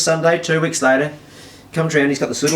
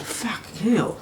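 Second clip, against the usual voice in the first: whispered, soft swearing; hushed disbelief; 8 semitones higher, 9 dB quieter, slower than usual. Retold or imitated. He waits while she's behind the house, hushed disbelief. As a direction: breathe it in a hush.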